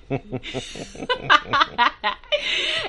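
high laughing fit; high burst of laughter. Real, not acted.

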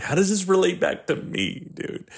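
high-pitched self-mocking question; mock self-centred. Selfish viewer relating the clip to himself, mock self-centred. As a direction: send up your own selfishness, pitch jumping up. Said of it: "I'm a selfish person".

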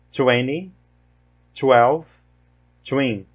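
"Tomorrow", "tonight", "two" with a clear English T; teacher modelling the T in "twenty", "twelve", "twin"; English T before W or O. Shown as a demonstration. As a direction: model each word slowly, one at a time. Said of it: "Mais uma vez".

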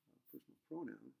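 ordinary talk, nothing marked

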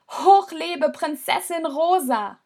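high, slow cheering cry; cheering shout. Acted. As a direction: call it out over the crowd. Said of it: "Maheles Schrei".

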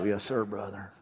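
low, soft Southern drawl; hushed, deep Southern reply; put-on Southern accent. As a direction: sound spiritual in a mock Southern accent. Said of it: "you have to talk in a southern accent".

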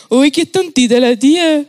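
lightly voiced teasing little boy; a hint of a smug kid; mocking child. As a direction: toss it off like a cheeky five-year-old. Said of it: "un niñito me dijo".

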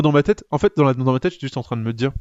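usual speaking voice

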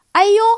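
sudden high loud ouch; startled yelp. A narrator's reading. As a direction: yelp out in surprise. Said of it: "塔克突然大叫一声".

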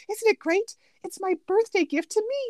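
high self-delighted brag; gleeful Kuzco. Retold or imitated. Quoting a favorite line, a high self-pleased Kuzco brag. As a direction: relish your own present. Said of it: "one of my favorite lines".

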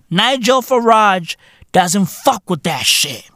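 normal talking voice

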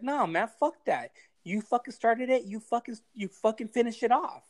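mock-scolding a quitter friend; aggressive retort. Telling a comedian who quit his podcast, heated. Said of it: "I'm very aggressive when it comes to podcasts".

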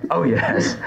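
normal talking voice

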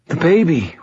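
high, insistent worry; anxious. A narrator's reading. Injured pregnant girl returns to her baby, anxious.

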